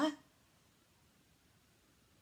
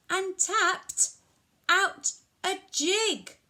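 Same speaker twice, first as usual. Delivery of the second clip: put-on reading to children; 2 semitones higher, 7 dB louder, slower than usual. A narrator's reading. Telling a child how the cow charged and danced, dramatic storytelling. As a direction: punch each action, pausing between phrases.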